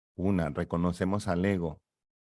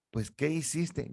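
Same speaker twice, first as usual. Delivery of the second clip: higher, softer prompting question; coaxing ego voice; 7 semitones higher, 5 dB quieter than usual. Acted. Ego prompting the baby what it did, higher softer coaxing voice. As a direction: gently nudge the baby to remember.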